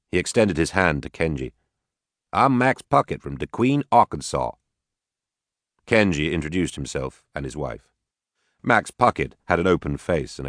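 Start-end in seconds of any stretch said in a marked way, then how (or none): 2.3-4.5 put-on easygoing Arkansas farmer, Southern accent. Max to the Watanabes, a light Southern accent. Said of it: "He had a heavy southern accent".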